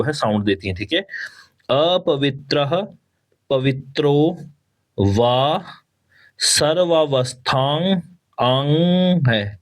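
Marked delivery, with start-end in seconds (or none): 1.7-8.0 अपवित्रः with final h, सर्वावस्थां ending in nasal aang; slow recitation. Shown as a demonstration. Teacher spells out the verse slowly for students. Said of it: "सर्वावस्था नहीं है, आंग है". 8.4-9.6 आंग drawn out with a nasal ng; nasal aang. Shown as a demonstration. Teacher isolates the nasal ending. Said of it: "सर्वावस्था नहीं है, आंग है".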